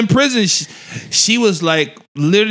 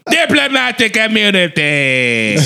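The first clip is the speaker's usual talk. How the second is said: slow, drawn-out South African villain line; Lethal Weapon two villain; 4 semitones higher, slower than usual. Retold or imitated. Comedian quoting the film's bad guy, mock villain accent. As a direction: imitate the movie's bad guy, higher and stretched. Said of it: "She sound like the bad guy from Lethal Weapon two".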